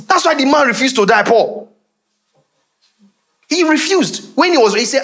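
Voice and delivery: high, forceful preaching cry; fiery preaching. Real, not acted. Preacher urging the congregation to lay hold, forceful.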